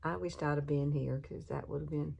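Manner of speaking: ordinary talk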